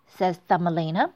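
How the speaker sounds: usual speaking voice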